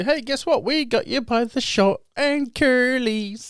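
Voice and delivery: very high mock sing-out, stretched at the end; gleeful gotcha voice. Government springing a forgotten bill on people, high mocking gotcha. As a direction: squeal it high, drawing out the last words.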